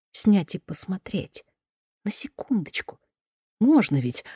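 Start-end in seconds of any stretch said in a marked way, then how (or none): 2.0-4.2 whispering. A narrator's reading. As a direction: breathe it as a secret plea. She pleads in a whisper.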